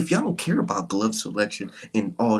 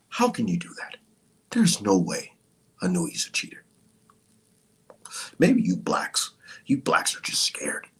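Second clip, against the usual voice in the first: mock fan whisper; 5 semitones lower than usual.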